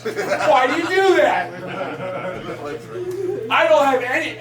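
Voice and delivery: usual speaking voice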